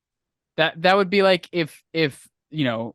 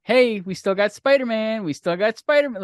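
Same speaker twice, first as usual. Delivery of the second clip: higher-pitched mock taunt; 6 semitones higher than usual. Sony teasing Disney over Spider-Man, taunting. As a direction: tease them like a gloating rival. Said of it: "it's almost like Sony's taunting Disney".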